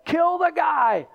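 high, loud mob yell, retold; mob shouting. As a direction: bellow it like a lynch mob. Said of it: "they're crying out".

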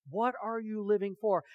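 ordinary talk

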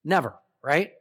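ordinary talk

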